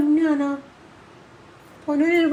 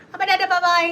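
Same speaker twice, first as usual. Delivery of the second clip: cutesy goodbye; 6 semitones higher than usual. Real, not acted. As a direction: sign off in a high pet-talk voice. Cutesy sign-off with the pet.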